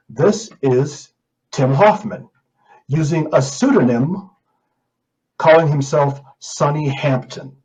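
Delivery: normal talking voice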